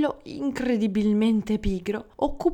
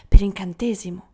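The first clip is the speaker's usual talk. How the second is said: usual speaking voice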